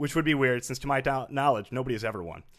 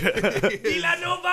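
laughing shout; 4 dB louder than usual. Pretend. Joining the Villanova shout.

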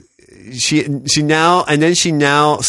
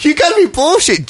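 disbelieving outburst; 13 semitones higher than usual. Retold or imitated. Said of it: "I'm like".

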